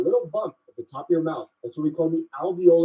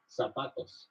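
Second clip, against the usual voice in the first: Mexican Spanish accent; 5 semitones lower, 12 dB quieter, slower than usual. Shown as a demonstration. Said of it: "In Mexico".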